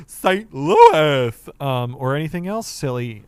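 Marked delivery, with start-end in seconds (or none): 0.1-1.5 loud, put-on Sanji cry. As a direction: belt it out in a character voice. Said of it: "you wanna make me say".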